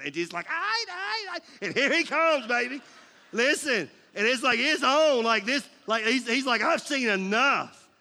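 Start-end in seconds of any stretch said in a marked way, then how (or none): 0.5-1.6 high singing guitar wail done with the voice; vocal guitar riff. Pretend. Preacher mimics the entrance music guitar, voiced guitar riff. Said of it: "that was actually my voice". 1.7-7.9 loud, pumped-up ring entrance call; hype announcer. Pretend. Preacher hypes the congregation for Jesus' arrival, loud hype call.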